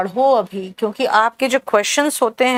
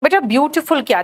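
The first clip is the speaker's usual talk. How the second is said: usual speaking voice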